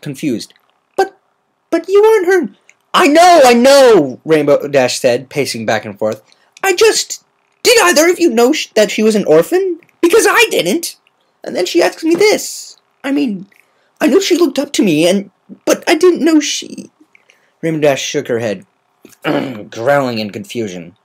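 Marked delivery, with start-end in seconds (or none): 1.0-2.5 much higher and louder, confused; a bubbly pony caught off guard; squeaky party pony, puzzled; Pinkie Pie voice. Acted. 2.9-4.2 higher, louder, slow exasperated repeat; fed-up pegasus brushing it off; frustrated Rainbow Dash. Acted. 6.6-16.9 higher voiced tomboy pegasus, upset; a worried young pegasus telling the news; Rainbow Dash voice. Rainbow Dash distressed rambling.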